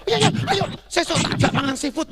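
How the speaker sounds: loud, high, imitating an excited friend; hearty acted invite to an old pal; eager invitation. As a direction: boisterously insist on treating him.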